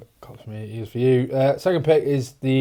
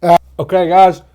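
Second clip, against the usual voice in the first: mouth burning from spicy food; 6 semitones higher, 10 dB louder than usual. Real, not acted. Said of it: "The tongue is on fire".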